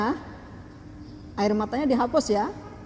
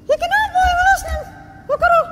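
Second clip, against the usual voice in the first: put-on high, loud childlike question; a child asking why; curious little girl; 10 dB louder, faster than usual. Child asks.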